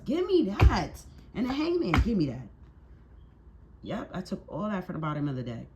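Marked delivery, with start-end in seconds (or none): 0.0-2.5 loud, high cry dropping at the end; emphatic exclaiming. Real, not acted. Tarot reader claiming the Hangman, emphatic shout. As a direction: call it out with force. Calling out cards as drawn.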